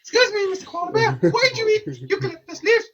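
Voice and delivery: high mock-polite question to a koala; high polite [name]. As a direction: put on a high, prim voice to ask. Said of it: "Walk up".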